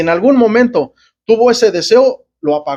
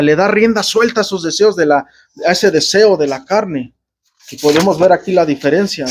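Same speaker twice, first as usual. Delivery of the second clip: ordinary talk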